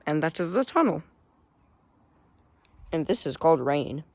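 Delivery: normal talking voice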